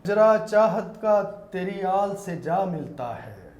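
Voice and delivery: slow, measured verse; naat recitation. Acted. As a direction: recite each word with weight. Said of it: "اسی کا ایک شعر ہے".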